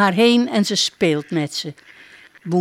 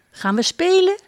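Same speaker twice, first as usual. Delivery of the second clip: put-on high, bright child's question; can't wait to play; eager question; 12 semitones higher than usual. Princess asking her duck to play, high and eager.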